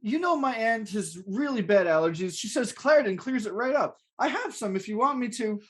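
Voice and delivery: high-pitched, hapless excuse-making tenant; meek tenant. Acted. Danny explaining himself to the landlord, high and meek. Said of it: "I'm gonna be Danny".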